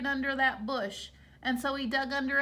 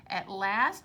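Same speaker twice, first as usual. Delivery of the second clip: plain reading voice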